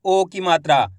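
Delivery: ordinary talk, nothing marked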